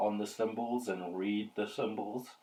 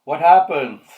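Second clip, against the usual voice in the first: eager; 5 semitones higher, 14 dB louder than usual. Real, not acted. Eager player asks the narrator.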